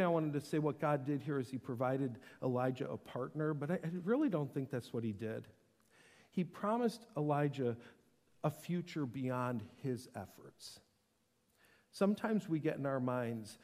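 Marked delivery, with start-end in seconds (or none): none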